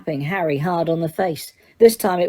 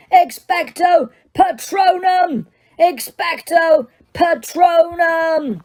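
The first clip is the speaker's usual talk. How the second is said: high, very slow shouted spell; desperate bellow; 10 semitones higher, slower than usual. Acted. Harry bellowing at the rising Dementor, slow. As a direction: pour everything into the charm. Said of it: "Harry bellowed".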